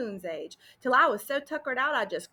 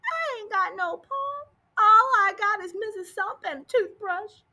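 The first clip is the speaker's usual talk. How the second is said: put-on crying little girl; 8 semitones higher, 5 dB louder than usual. A narrator's reading. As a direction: sob it out like a lost child. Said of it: "she went right on bawling".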